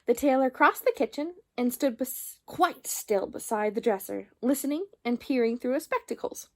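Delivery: plain reading voice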